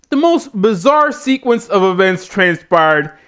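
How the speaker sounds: ordinary talk, nothing marked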